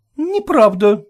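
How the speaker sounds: high, sharp protest; lady shouting back at landlord; indignant shout. A narrator's reading. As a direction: stand up to the landlord. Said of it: "крикнула женщина".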